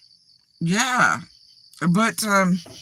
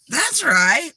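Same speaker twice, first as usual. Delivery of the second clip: high, eager, emphatic agreement; imitated tone change; 10 semitones higher, 4 dB louder than usual. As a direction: pitch it way up, mimicking sudden enthusiasm. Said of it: "If you know tones from other human beings".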